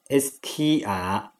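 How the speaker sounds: ordinary talk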